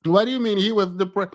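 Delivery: normal talking voice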